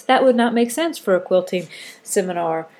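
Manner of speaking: ordinary delivery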